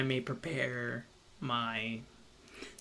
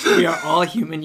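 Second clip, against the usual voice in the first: laughing, louder, stress on WE; 3 semitones higher, 12 dB louder than usual. Real, not acted. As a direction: say it again through a laugh. Repeats line amid laughter.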